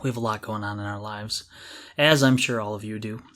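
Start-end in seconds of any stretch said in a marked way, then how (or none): none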